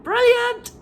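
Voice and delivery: very high, loud gleeful squeal; high delighted old wife; Missus Twit delighted. Acted. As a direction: shriek it with nasty glee.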